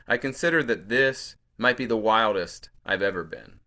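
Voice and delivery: lifted, deliberate poem recital; poetry reading. Acted. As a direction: read each phrase out as verse. Reads own poem aloud.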